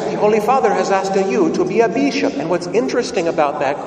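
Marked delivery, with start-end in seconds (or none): 0.0-2.3 put-on thick Italian accent. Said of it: "in a thick Italian accent".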